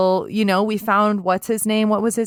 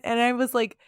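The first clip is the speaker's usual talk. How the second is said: ordinary talk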